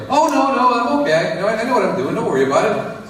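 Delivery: high, over-eager Tommy; defensive Tommy. Retold or imitated. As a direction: brush off the neighbor nervously. Tommy voiced answering neighbor.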